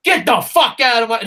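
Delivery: fake-snap at him; hammed-up angry shout; mock angry outburst. Mock kicking Vince out.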